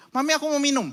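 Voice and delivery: put-on high, small child's plea. Son asks mami for a drink, high child voice.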